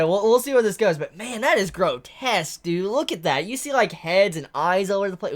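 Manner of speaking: ordinary delivery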